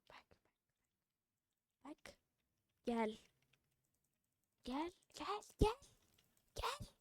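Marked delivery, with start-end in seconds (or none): none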